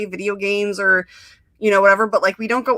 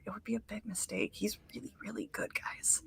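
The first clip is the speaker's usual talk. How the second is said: whispered aside, voice dropped to breath; 2 semitones higher, 19 dB quieter than usual. Real, not acted. Urging listeners to draft Alex, in a whisper. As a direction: lean in and whisper it like a secret tip.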